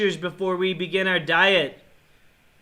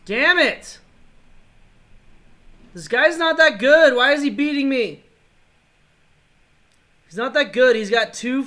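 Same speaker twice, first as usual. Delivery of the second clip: loud, higher angry rant; frustrated yelling; 5 semitones higher, 7 dB louder than usual. Real, not acted. Gamer furious his opponent is beating him, loud ranting. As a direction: rant at the screen.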